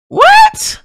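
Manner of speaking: very high-pitched "what"; sudden falsetto yelp of disbelief; shocked squeal. Real, not acted. Baffled by game's nightmare line.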